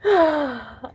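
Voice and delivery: high, falling emotional sigh; heavy sigh. Real, not acted. Woman holding in tears for days, heavy sigh. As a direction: let out a real, tearful sigh. Breaking down after long tearful pause.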